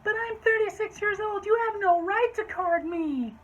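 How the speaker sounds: high, loud protest in a put-on voice; hammed-up shrieky objection; indignant customer. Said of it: "To which she said".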